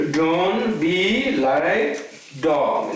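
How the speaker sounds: "Don't be like dog" very slow, rising on "be"; slow, drawn-out saying. Shown as a demonstration. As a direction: drag out each word for weight. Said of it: "You heard this, example before?".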